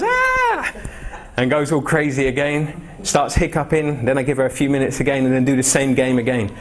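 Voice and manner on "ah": very high shrieking squeal; imitated toddler squeal. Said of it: "and then she goes".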